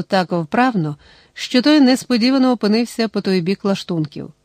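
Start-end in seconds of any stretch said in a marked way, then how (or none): none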